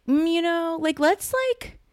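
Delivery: very high, hedging mock voice; mock hesitant studio. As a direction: imitate squeamish executives backing away. Mimics hesitant studio executives.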